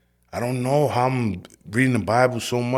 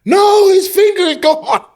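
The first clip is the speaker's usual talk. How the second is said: very high, loud panicked cellmate scream; frantic scream; 12 dB louder than usual. Retold or imitated. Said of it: "my cellie starts screaming".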